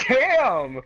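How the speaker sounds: loud, high mock reaction; retold amazed exclamation. Friend hearing the fighter's excuse, amazed exclamation. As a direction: punch out an impressed outburst.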